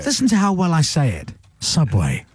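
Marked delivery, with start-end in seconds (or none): 1.6-2.3 very low, measured brand voice; deep, weighty tagline delivery; serious voiceover. Shown as a demonstration. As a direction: drop right down for a serious ad read. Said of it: "I can do the serious voice".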